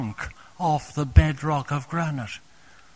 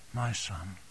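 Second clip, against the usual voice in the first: tender fatherly murmur; 7 semitones lower, 9 dB quieter than usual. Acted. Heavenly Father speaking to believer.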